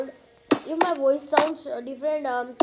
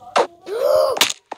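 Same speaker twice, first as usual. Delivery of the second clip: high rising gasp of alarm; startled gasp; 5 semitones higher, 6 dB louder than usual. Real, not acted. Player gasps at zombie villager nearby. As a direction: gasp as danger appears.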